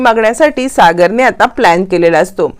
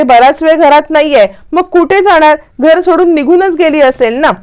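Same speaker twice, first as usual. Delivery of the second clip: panicking; 6 semitones higher than usual. A narrator's reading. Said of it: "असं म्हणत आता Indra panic होत असते".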